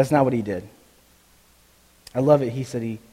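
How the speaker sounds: ordinary talk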